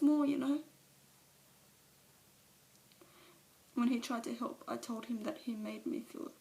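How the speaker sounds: Alice breaking down before the meeting; tearful confession. Acted. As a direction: let the shame and grief spill over. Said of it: "a monologue from one of, of her movies".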